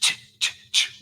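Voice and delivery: unvoiced rhythmic scraping "ch"; imitated knife sharpening. Buddy sharpening the knife in the corner, imitated scrapes. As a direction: make short blade-on-stone noises.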